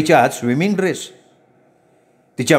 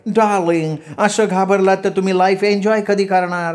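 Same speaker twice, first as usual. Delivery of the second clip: higher-pitched woman's voice; chiding sweetheart; 5 semitones higher than usual. A narrator's reading. Woman chiding her timid darling, high voice. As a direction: chide him in a raised, put-on pitch.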